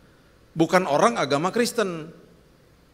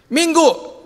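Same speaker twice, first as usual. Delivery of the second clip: pushy asking; 10 semitones higher, 6 dB louder than usual. Retold or imitated. Pestering friend to meet for coffee.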